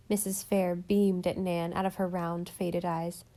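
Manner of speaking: usual speaking voice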